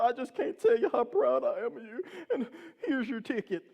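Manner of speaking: high, mock-tearful gushing; hammed-up weepy officer, pitched high; imitated bawling cop. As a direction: choke up with mock pride. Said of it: "just bawling".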